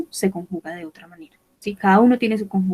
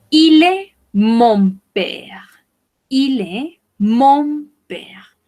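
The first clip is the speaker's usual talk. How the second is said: slow model reading; 4 semitones higher, 4 dB louder, much slower than usual. Shown as a demonstration. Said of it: "teacher models French phrase".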